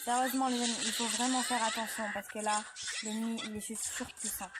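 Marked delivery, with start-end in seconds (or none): none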